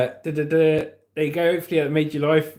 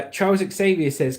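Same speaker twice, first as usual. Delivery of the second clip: ordinary talk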